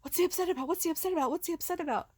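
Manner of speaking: high puzzled voice, asking over and over; confused. Retold or imitated. The noodles puzzling over husband's anger, high and bewildered. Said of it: "all of us noodles are searching, like".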